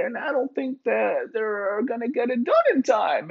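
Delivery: high, nerdy rambling voice; Bill Gates voice. Retold or imitated. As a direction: ramble like a tech nerd. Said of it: "Bill's like".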